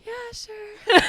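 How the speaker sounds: high, loud forced cheer; fake cheerful yes. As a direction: squeak out a reluctant yes. Retold reluctant yes to friend.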